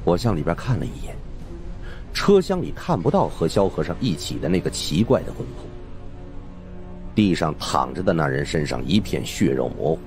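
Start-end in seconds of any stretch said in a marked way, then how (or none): none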